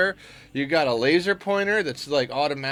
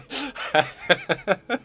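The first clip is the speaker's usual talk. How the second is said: helpless giggling at own joke; real laughing fit; 5 semitones higher than usual. Real, not acted. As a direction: crack up at your own silly joke.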